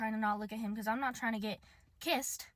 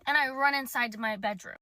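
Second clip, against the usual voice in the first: ordinary delivery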